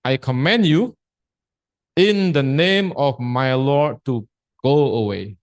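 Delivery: slow, deliberate English reading; slow read-aloud quote. Shown as a demonstration. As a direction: read it out slowly, word by word. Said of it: "Ini ya".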